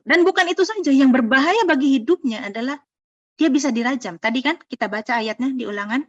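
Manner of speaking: ordinary talk, nothing marked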